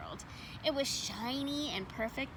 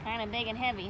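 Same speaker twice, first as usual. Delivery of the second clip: usual speaking voice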